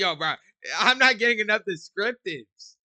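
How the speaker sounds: loud exasperated yell; shouting. Real, not acted. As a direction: shout it at the screen. Yelling at the screen.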